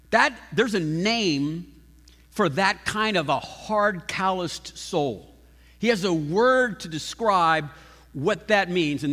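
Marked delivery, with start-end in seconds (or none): none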